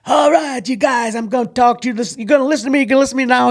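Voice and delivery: booming high drill sergeant bark. Retold or imitated. Drill sergeant booming at new recruits, loud and high. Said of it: "they all got the same voice".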